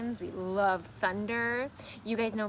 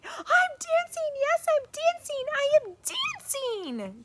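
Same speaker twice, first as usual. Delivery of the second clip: very high, loud delighted shout; joyful cry; 7 dB louder, slower than usual. Acted. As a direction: cry out in pure joy. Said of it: "Gerald cried".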